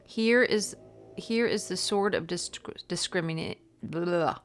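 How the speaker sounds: emphatic, projected book reading; reading aloud. Real, not acted. Tarot reader reading about the Justice card, emphatic read-aloud. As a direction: deliver the passage with punched key words.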